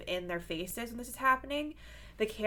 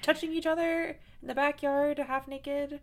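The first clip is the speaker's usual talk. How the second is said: high, hammed-up baffled questioning; mock bewildered guy; 9 semitones higher, 4 dB louder than usual. Friend acting out a baffled man grabbed in the backyard, mock confusion. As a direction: play a flustered man caught off guard, pitched up.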